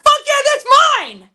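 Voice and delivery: high, loud burst of delight; excited retold outburst. Retelling finding a shared favorite song, thrilled yell. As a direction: re-enact thrilled agreement, pitch shooting up.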